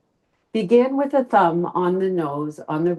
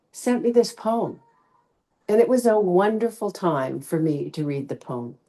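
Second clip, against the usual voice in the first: usual speaking voice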